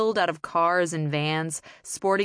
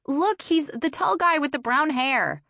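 insistent; 9 semitones higher, 4 dB louder than usual. A narrator's reading. Impatient girl insisting.

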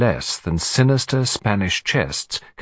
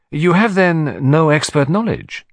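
higher, deliberate questioning; Hercule Poirot; 6 semitones higher, 3 dB louder than usual. Acted. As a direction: probe with courteous deliberation. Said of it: "said Poirot".

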